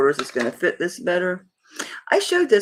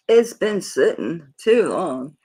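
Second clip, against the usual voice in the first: normal talking voice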